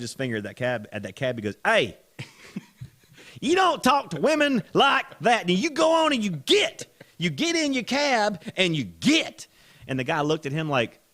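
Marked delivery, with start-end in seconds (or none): 1.6-9.5 high, loud, slow reprimand; mock scolding shout. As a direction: order him off, word by word. Acting Gene scolding the cab driver.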